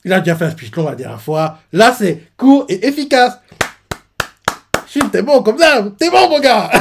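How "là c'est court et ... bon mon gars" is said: high, loud, laughing praise; excited. Real, not acted. Fan praising Shin, excitedly. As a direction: cheer him on.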